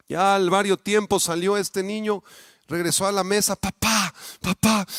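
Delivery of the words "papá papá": crying. Retold or imitated. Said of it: "así llorando".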